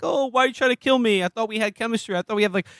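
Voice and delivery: high-pitched, plaintive imitation; mock hurt pleading. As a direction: plead as the wounded would-be lover. Retelling the man's hurt pleading.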